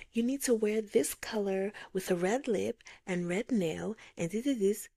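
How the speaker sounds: high, prim imitation of a femininity coach; mock coach voice. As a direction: parrot the coach's advice in a prissy tone. Mocking femininity coach advice.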